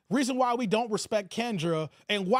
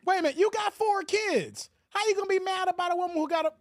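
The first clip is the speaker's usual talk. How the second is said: can't believe what he hears; incredulous; 6 semitones higher, faster than usual. Real, not acted. Incredulous host reacts.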